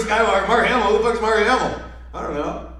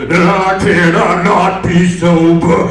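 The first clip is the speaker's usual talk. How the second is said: slow, loud, distorted mock villain; hammed-up garbled villain voice; masked villain voice; 2 semitones lower, 5 dB louder, much slower than usual. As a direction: drag it out like a garbled masked villain, ending in gurgles.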